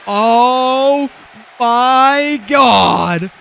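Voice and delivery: sudden loud, high "oh my God"; shocked gasp. Real, not acted. Commentator stunned by a table spot, shouting high.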